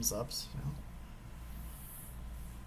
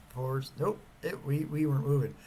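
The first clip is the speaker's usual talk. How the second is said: ordinary delivery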